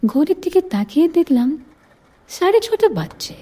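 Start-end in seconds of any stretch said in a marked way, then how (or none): none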